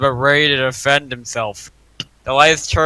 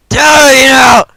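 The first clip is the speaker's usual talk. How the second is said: loud, higher hammed-up shout; shouted line; 9 semitones higher, 13 dB louder, slower than usual. Mark shouts at the stranger, loud hammed shout. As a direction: bellow it like a fanfic hero. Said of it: "Mark shouted".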